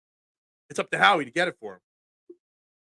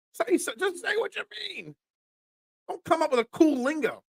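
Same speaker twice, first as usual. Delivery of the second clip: squeaky-high exasperated plea; 11 semitones higher than usual. Real, not acted. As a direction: voice shoots way up in exasperation. Exasperated rant about slang.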